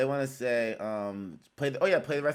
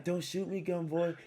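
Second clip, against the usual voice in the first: higher, softer mock girl's plea; girl asking for help; 7 semitones higher, 5 dB quieter than usual. Rapper imitates his girl tag, high and pleading. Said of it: "like a girl is, like, asking for help".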